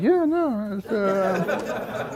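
high-pitched mock sheepish dodge. Friends caught hiding the review, imitated sheepish evasion. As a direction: imitate friends squirming, trailing into mumbles.

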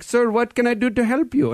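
put-on high Indian-accented scam caller; imitated Indian tech-support voice. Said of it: "with a strange Indian accent".

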